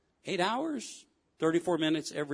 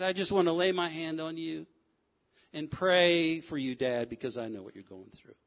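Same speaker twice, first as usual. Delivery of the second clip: tender; 3 semitones lower, 3 dB quieter than usual. Acted. Preacher offering to pray for a struggling dad, tender. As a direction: gently offer comfort, voice dropping away.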